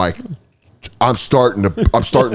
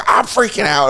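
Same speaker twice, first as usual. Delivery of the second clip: high-pitched echo of panic; mock panic; 9 semitones higher than usual. Friend answering a tripping man, high mock panic. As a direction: answer in equal, comic panic.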